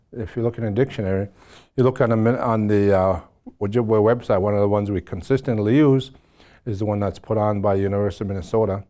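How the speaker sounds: ordinary talk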